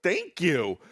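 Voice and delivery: high, astonished thanks; shocked. Retold or imitated. Child receiving mom's gift, shocked and grateful. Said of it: "I was so shocked".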